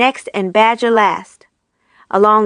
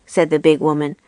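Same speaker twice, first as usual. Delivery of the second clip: ordinary delivery, nothing marked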